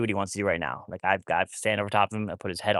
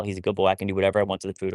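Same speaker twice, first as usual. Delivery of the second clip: ordinary delivery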